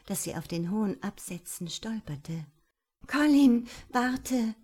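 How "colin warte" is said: higher, louder cry to wait; raised, urgent woman's call; alarmed call. A narrator's reading. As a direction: call out urgently to stop him. Stumbling, calls him to wait.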